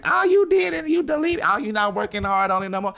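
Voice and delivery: high, loud mocking imitation of friends. Mocking her friends' remarks.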